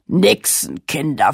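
loud, slow scolding Nixenkinder, stretched with a falling end; angry king. Acted. The sea king scolding the caught children, slow angry roar. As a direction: growl Nixenkinder slowly at the caught mermaids.

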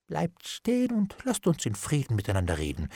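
hushed, coaxing appeal; soft plea. A narrator's reading. Bailiff begs Hendon to talk in peace, softly coaxing. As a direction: plead softly, easing downward.

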